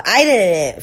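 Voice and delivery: ordinary delivery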